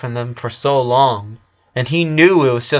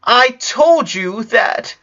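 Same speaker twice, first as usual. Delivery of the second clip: starting to shout; 10 semitones higher, 4 dB louder, much slower than usual. A narrator's reading. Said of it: "started to shout Lincoln".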